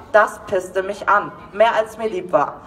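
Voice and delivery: plain reading voice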